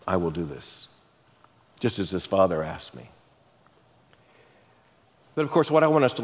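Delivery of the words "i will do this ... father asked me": very low and hushed; a soft, settled promise; kind promise. Retold or imitated. Jesus promising to do what the father asked, quiet and kind. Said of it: "Jesus is kind when they ask him".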